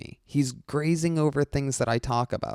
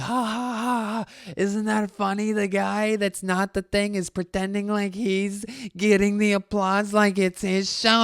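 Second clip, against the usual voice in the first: mocking crowd; 10 semitones higher, 3 dB louder than usual. Crowd laughing at the fan on stage, high mocking voice. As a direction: jeer like a laughing audience. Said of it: "pointing and laughing".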